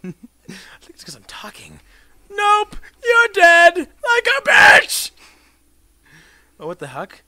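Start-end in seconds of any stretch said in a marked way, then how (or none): 0.8-1.8 whispered aside. Real, not acted. 2.3-5.1 high loud slow jeering shout; kill taunt. Real, not acted. Shouting at the player he just killed.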